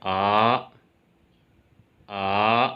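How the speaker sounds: आ in Mandarin rising tone; rising second tone. Shown as a demonstration. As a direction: lift आ upward like a question. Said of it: "second tone place करें तो इसकी sound आएगी".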